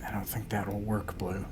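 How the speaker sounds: hushed, breathy whisper. Acted. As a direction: whisper so nobody hears. Character hiding, needs to stay unseen.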